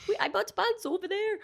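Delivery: high, hammed-up attempt at a German accent; failed German accent. Friend imitating German tourists in line, badly faked German accent. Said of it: "I can't do the German accent".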